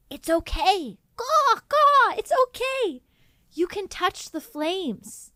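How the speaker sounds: high cawing crow, soothing but squawky. Acted. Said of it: "And Nicolas Cage says".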